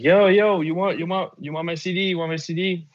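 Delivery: a hint of a high pushy street seller; street hustler. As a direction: hustler pushing his CD on kids. Mixtape seller voiced in story.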